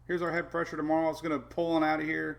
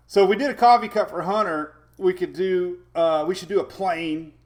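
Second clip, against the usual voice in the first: excited; 4 semitones higher, 10 dB louder than usual. Real, not acted. Excited idea.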